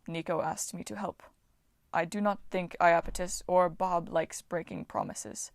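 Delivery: lightly voiced simple-speaking Titan; a hint of a gentle giant. A narrator's reading.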